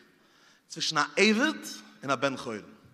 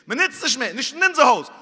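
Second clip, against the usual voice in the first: emphatic repeat; 6 semitones higher, 8 dB louder than usual. Acted.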